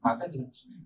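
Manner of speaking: soft, inward devotional murmur; heartfelt affirmation. Shown as a demonstration. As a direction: say it softly, feeling its vibration. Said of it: "don't you feel a vibration in this sentence".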